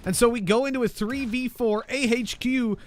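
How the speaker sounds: high, loud hype call; esports caster. Acted. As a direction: ride the tension of the retake.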